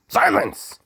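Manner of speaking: very high, loud cry of silence; commanding shout. Acted. The Duke commands silence, very high and loud. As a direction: bellow it to shut everyone up.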